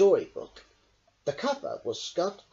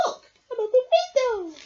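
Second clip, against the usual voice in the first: high, louder squeaky little voice; squeak; 8 dB louder than usual. Acted. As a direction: squeak out the discovery. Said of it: "squeaked Mouse".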